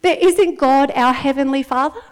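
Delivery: usual speaking voice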